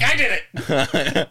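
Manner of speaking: excited, slow hammed-up dog voice; imitating the dog. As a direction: voice the proud dog while laughing. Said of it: "Brock is like".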